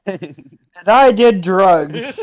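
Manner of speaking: mock drawl. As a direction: stretch it out mockingly through laughs. Mocking the other player's line.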